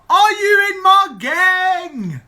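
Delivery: high, loud, stretched-out shout; mock battle cry, slow and high; playful holler. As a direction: yell it like rallying a fan club. Calling out to a fellow fan.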